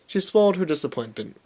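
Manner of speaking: plain reading voice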